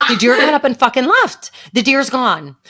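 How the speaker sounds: loud, high hysterical yell; losing it completely. Retold or imitated. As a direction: re-enact a full freak-out, shouting. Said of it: "then I lost my shit completely".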